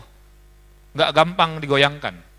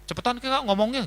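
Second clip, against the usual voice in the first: impatient; 6 semitones higher, 4 dB quieter than usual. Retold or imitated. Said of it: "giliran dengar orang lain ngomong".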